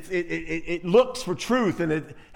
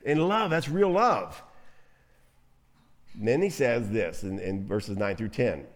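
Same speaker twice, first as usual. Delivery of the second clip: ordinary delivery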